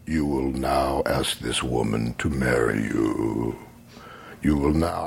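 low, slow voice from beyond; deep commanding voice. Retold or imitated. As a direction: intone it like a solemn oracle. Mystical voice commands him to propose.

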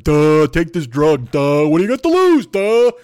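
loud, high, slow dim-witted drawl; mock idiot boss voice; dopey Trump impression. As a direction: ham it up like a dull-witted blowhard. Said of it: "the fucking Wookiee that runs this fucking country is just like".

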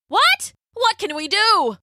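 very high startled outcry; high-pitched storybook panic; alarmed villager. A narrator's reading. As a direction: exclaim in sudden dismay.

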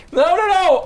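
high loud panicked no; panicked shout. Real, not acted. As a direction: yelp it in alarm. Panic as his page is shown.

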